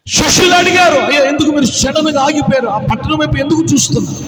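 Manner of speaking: loud Telugu pulpit delivery; fiery preaching. Acted. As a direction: preach it out to the crowd. Preaching at full force.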